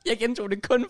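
high laughter spilling into the words; high, giggly confession; laughing. Real, not acted. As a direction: crack up, then talk through the laugh. Cracks up after teasing with medister.

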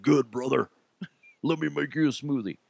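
a light wrestler voice, not far from his own; Hulk Hogan impression. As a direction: lightly imitate a gruff wrestler with a laugh. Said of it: "Spot on Hulk Hogan".

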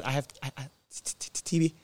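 hushed, stuttering disbelief; stunned stammer. Retold or imitated. Patient just told he has TB, imitated stunned stammer. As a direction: trip over the letters, barely any voice at first. Retold stunned reaction to diagnosis.

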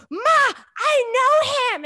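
high, loud, slow excited squeal. Retold or imitated. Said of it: "I was like".

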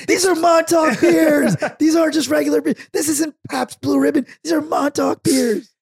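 hammed-up fury over fancy beer; mock outrage. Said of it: "I can imagine you on the L I E entrance going".

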